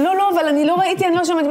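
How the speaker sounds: high, flustered excuses; reenacted panic in self-defence; retold panicked protest. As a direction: protest frantically, pitch way up. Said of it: "אני בכזאת פאניקה".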